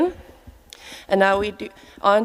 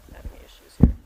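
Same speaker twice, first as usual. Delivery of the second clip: a whispered aside to himself. Real, not acted. Host fumbling with the microphone, whispering to himself. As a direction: whisper it under your breath, off mic.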